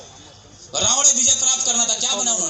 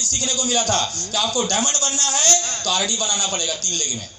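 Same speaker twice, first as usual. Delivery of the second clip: ordinary talk, nothing marked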